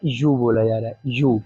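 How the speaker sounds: यू said as a clear low "yoo"; sound yu. Shown as a demonstration. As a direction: give यू a long "yoo" sound at the start. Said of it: "बल्कि यहाँ पे क्या बोला जा रहा है".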